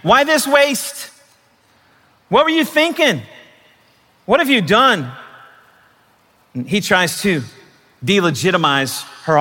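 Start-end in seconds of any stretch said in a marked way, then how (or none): 0.0-5.1 high, drawn-out scolding accusations; accusing enemy voice. Acted. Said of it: "The first voice of the enemy".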